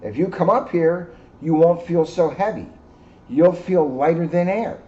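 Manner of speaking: lightly voiced moon, coaxing them upward. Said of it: "she called to the lumbering dinosaurs".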